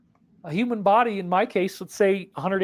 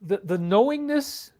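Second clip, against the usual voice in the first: ordinary talk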